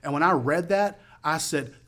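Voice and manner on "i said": normal talking voice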